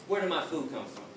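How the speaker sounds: usual speaking voice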